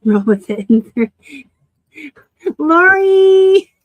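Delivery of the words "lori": loud call across the house. Real, not acted. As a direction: shout her name to another room. Calling out to Lori, laughing.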